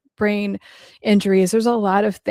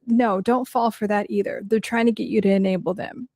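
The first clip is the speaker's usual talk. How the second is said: ordinary talk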